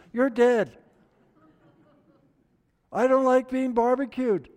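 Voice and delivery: high, loud comic protest; acted panicked refusal. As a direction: refuse loudly in mock alarm, pitch way up.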